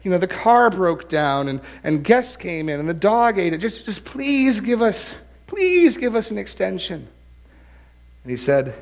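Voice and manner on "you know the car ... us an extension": high-pitched, hammed-up begging students; mock-frantic begging for mercy; quaking plea. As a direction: plead desperately, drawing out "please". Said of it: "five students sort of shaking and quaking".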